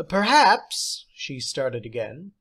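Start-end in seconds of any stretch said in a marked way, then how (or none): 0.1-1.1 high, louder teacher's voice; theatrical teacher. Acted. Substitute teacher praising John, high and theatrical. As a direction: play the odd substitute, grand and pleased.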